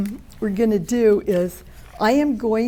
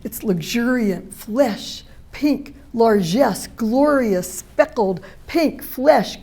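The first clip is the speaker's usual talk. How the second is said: louder, slow and lingering, savouring each image; poetry reading; 5 dB louder than usual. Acted. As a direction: recite the poem with sensual relish, pausing between words. Reads her own poem aloud.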